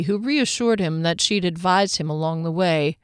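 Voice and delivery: usual speaking voice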